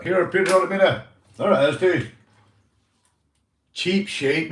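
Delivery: usual speaking voice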